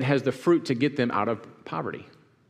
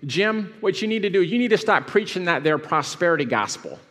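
high, Southern preacher twang; Southern religious spirit voice; 5 semitones higher, 3 dB louder than usual. Acted. Scolding Jim about the prosperity gospel, Southern preacher voice. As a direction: preach it down South, pitched well up. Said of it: "this is my religious spirit voice".

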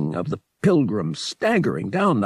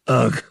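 loud grossed-out grunt; disgusted ugh; 2 semitones higher, 5 dB louder than usual. Acted. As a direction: recoil in disgust. Han sees the slimy Hutt.

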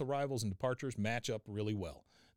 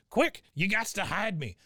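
high, urgent put-on voice; mock panic of a cornered fugitive; Cotton; 8 semitones higher, 4 dB louder than usual. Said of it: "you hear the police siren approaching".

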